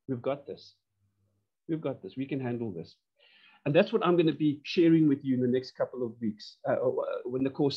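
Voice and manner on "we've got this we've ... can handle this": softer, hushed, steady reassurance; a leader quietly soothing shareholders; calm reassurance. Pretend. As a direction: settle the panicking board gently. Leader calming board, softly.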